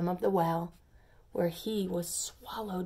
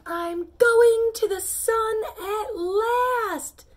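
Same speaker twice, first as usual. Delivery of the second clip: very high, loud, slow cry of triumph; a long high triumphant cry to the sky; exclaiming; 13 semitones higher, 8 dB louder, much slower than usual. Acted. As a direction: soar up crying out in delight. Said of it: "exclaimed the first pea".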